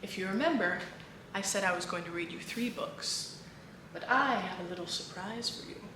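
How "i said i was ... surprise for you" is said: ordinary delivery, nothing marked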